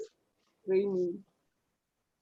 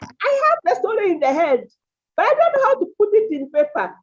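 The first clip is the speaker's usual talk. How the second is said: higher, louder quote of writers; lightly acted writer's complaint, pitched up; voicing complaining writers; 8 semitones higher, 4 dB louder than usual. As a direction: imitate writers pleading their problem. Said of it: "I get that quite a lot from my writers".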